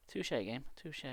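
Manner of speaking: hushed, breathy aside; whispered touché. Real, not acted. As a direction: whisper it to the game. Whispered reply in library.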